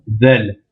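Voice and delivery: del with tongue tip between the teeth; Arabic dhal sound; voiced th del. Shown as a demonstration. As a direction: del said with the th of there. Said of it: "Le bout de la langue entre les dents de devant".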